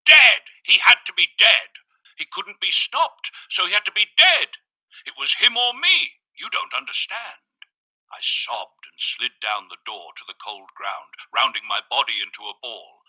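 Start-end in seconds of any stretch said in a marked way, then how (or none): 0.0-7.7 much higher, loud breaking screams; screaming, voice breaking. A narrator's reading. Narrator screaming her confession at the visitor, voice breaking. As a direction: scream it out, voice cracking. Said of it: "I screamed, my voice breaking".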